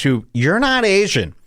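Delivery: usual speaking voice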